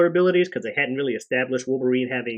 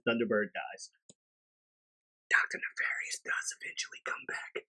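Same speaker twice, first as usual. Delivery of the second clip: ordinary delivery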